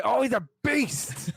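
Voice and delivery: big, slow, laughing hype call; excited commentator. Retold or imitated. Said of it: "He's like".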